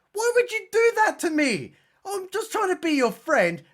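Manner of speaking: high, wounded little voice; mock-sad predator pleading; whiny hurt predator. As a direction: play the predator as a hurt friend.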